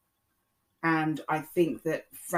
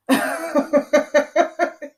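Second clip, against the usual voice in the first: loud high laugh at the memory; 10 semitones higher, 9 dB louder than usual. Real, not acted. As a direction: burst out laughing. Laughs at mum imitation.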